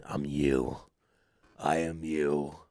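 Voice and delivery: very low, hushed villain voice; low mirror-self voice; sinister alter ego. Retold or imitated. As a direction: drop deep and quiet, coaxing like a dark side. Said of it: "a different personality".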